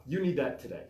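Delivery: usual speaking voice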